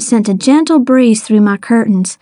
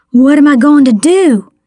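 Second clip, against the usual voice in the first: urgent demanding question; 4 semitones higher, 3 dB louder than usual. A narrator's reading. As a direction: press for an answer. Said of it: "he demanded".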